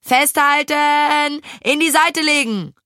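high, drawn-out urgent orders; shouted commands. A narrator's reading. Maja steers into the dangerous curve, shouted commands. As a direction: shout instructions to the riders.

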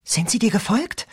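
lightly voiced teen girl; girl's voice. A narrator's reading. Rachel voiced.

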